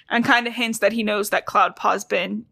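normal talking voice

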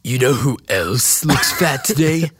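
slow, put-on setup to the punchline; Muscle Man. Answering a fat insult from the co-host, slow character voice. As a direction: draw out the taunt in character. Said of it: "say it in his voice".

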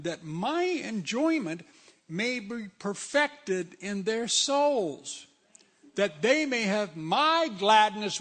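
slow, fervent preaching of the verse; impassioned sermon reading. Acted. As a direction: lean into each word like a promise.

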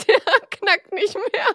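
giggling, high and slow; laughing. Real, not acted. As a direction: laugh through the words. Laughing while cracking cookie.

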